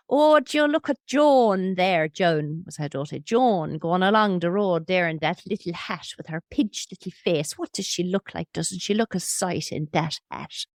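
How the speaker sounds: grandmother's Irish lilt, lightly put on; a hint of a mean Irish granny; Irish accent. Granny sneering at her daughter in an Irish accent. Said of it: "so I'm gonna do the accent".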